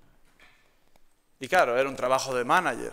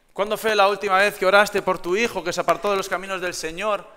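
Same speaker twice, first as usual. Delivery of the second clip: rising sermon appeal, louder; preaching; 4 semitones higher, 4 dB louder than usual. Acted. Pastor challenging the church to pray, building intensity. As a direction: press the congregation with questions.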